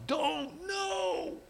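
high, soft falsetto shout; muted mock scream. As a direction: act a scream heard from far underwater. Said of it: "shouting at the top of my lungs".

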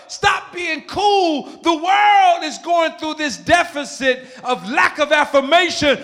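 high, loud, impassioned exhortation; full-force preaching. Real, not acted. Preacher urging congregation to affirm the world, full-force exhortation. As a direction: build to a drawn-out preacher's shout.

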